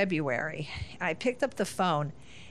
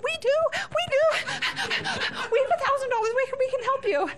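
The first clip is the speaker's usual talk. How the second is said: very high mock squeal; hammed-up urge to rescue, squeaking out; desperate eagerness; faster than usual. Mother bursting to bail out her son, squealing eagerly.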